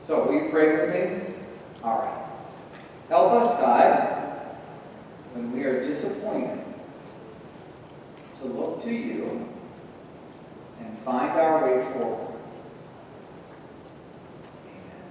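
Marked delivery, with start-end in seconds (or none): 3.1-15.1 hushed closing prayer, fading on amen; soft prayer. Acted. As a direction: pray gently to God for the children. Leads children in prayer.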